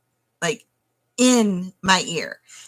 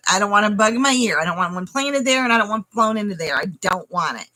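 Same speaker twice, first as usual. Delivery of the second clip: normal talking voice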